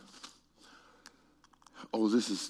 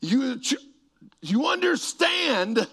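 emphatic shout; 9 semitones higher, slower than usual. Real, not acted. Preacher pressing congregation.

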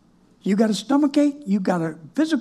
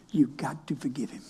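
whisper; 8 semitones lower, 9 dB quieter than usual. Acted. Hushed aside in sermon.

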